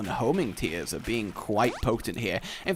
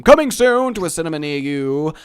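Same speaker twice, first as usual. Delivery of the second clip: louder mock trailer voice-over; film trailer announcer; 3 semitones higher, 8 dB louder than usual. Promoting a pretentious film, as trailer announcer. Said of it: "sounds like a really pretentious film".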